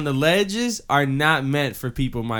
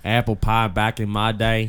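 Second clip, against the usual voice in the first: lower, folksy Southern accent; 4 semitones lower than usual. Shown as a demonstration. Friend shows off over apple pie, put-on Southern accent. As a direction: say it like a Southern old-timer. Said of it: "I got a really good Southern accent".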